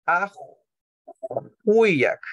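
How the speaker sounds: Ajuiak said slowly with a clear W like a long U; model pronunciation. Shown as a demonstration. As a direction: sound out Ajuiak carefully for the class. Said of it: "La W es como una U larga".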